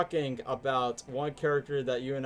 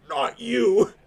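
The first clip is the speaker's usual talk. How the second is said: Eggman voice; 9 semitones higher, 9 dB louder than usual. Retold or imitated. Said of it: "Eggman's like".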